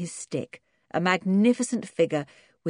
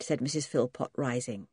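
plain reading voice

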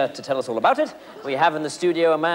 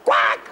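duck quack; 6 dB louder than usual. Acted. Interviewer's life reflex.

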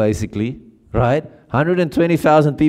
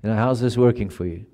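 God's quiet question; 3 semitones lower, 6 dB quieter than usual. Retold or imitated. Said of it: "and going".

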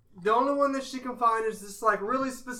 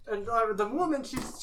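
ordinary talk, nothing marked

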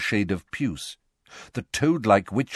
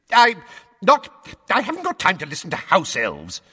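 higher, louder sputtering shout; 5 dB louder than usual. A narrator's reading. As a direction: burst out, losing patience. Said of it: "Fudge shouted".

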